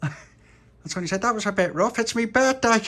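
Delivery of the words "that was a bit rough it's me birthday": high, rising, loud whine; aggrieved birthday boy. Acted. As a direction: whine it, building louder at the end. Tony complains.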